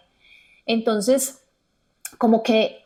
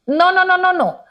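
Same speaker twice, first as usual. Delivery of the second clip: higher, louder no no no; harsh no; 9 semitones higher, 6 dB louder than usual. Retold or imitated. Louder, higher mock-harsh no to a partner's new project. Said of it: "decirle a tu pareja".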